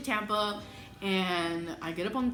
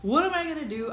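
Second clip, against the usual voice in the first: high, louder panicked exclamation; 9 semitones higher, 4 dB louder than usual. Retold or imitated. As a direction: freak out as the water breaks. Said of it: "and I was like".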